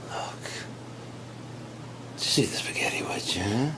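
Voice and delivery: hushed whispered threat; soft whispered warning. Acted. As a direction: lean in and whisper it. Quiet threat over spaghetti.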